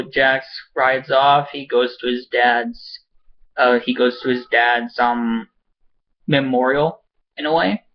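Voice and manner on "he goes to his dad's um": ordinary talk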